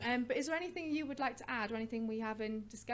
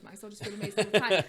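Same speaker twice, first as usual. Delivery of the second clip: joking exclaim; 12 dB louder than usual. Real, not acted.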